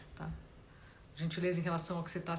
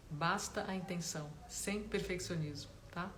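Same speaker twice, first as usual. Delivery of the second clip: guided meditation; 7 dB quieter than usual. Real, not acted. Meditation teacher guiding listeners to notice body and breath, calm guided-meditation voice. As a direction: gently guide them inward, leaving space between phrases.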